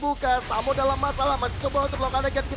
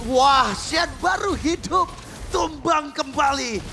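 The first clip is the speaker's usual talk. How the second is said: loud, drawn-out excited cry; excited shout; 8 semitones higher, 6 dB louder, much slower than usual. Real, not acted. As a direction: shout it out slowly, thrilled. Caster reacts to hero kill.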